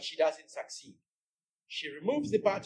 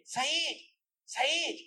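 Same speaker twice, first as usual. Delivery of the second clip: a higher, half-held call; mock scream; 11 semitones higher than usual. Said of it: "she screams again".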